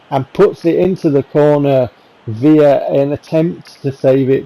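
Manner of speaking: normal talking voice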